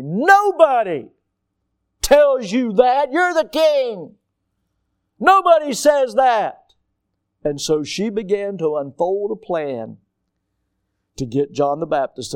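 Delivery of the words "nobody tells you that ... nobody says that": high, loud, slow indignant outburst; angry queen. Acted. Herodias to the king, an outraged high, loud protest. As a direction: spit it out, outraged she was crossed. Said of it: "and she is angry".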